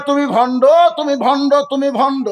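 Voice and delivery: high, chanted cry in repeated bursts; shouted accusation. A narrator's reading. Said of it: "রাখাল চিল্লায় বলে". (চিৎকার করে সুরে অভিযোগ)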